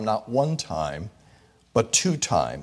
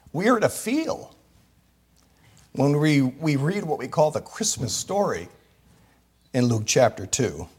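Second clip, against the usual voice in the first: ordinary talk, nothing marked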